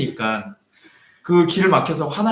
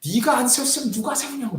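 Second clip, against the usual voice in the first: put-on old man's anger; 10 semitones higher than usual. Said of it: "화를 내시는 거예요".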